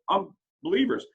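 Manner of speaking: ordinary delivery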